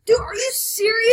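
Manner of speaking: high, loud and slow disbelief; outraged shout. Real, not acted. Teammate just killed him.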